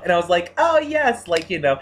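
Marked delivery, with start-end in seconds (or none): 0.6-1.3 gleeful yes. Retold or imitated. Gleeful agreement quote.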